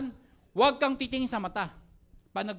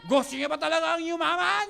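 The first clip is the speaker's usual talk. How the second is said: louder hype question; 5 semitones higher, 6 dB louder than usual. Shown as a demonstration. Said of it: "tatanungin mo yung crowd".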